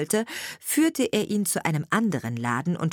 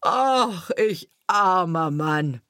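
slow, drawn-out carter's moan; wailing lament; 4 dB louder, much slower than usual. A narrator's reading. Carter finding his wine barrel empty, slow wailing lament. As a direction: cry out in self-pity, stretching the words. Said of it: "rief er".

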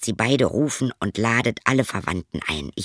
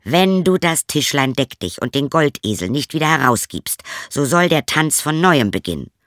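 firm, louder threat; 6 semitones higher, 4 dB louder than usual. Acted. Turner threatens the beaten innkeeper, stern and loud. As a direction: lean on the innkeeper hard. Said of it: "Da sprach der Drechsler".

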